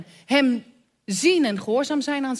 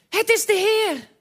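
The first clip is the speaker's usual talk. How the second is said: uitroep van de leerling; 8 semitones higher than usual. A narrator's reading. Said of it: "zei tegen Petrus".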